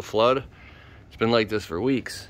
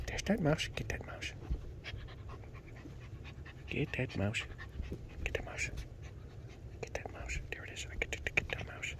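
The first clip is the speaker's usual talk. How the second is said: whisper; 2 semitones higher, 17 dB quieter than usual. Real, not acted. Whispered teasing.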